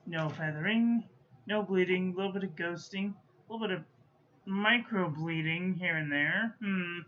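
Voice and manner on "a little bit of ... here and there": ordinary delivery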